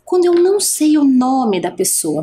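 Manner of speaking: usual speaking voice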